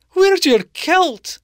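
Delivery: high, loud and slow alarmed call. A narrator's reading. Wife calls to husband about missing kilt, high slow alarm. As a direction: call out across the yard in shock. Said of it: "she called to her husband".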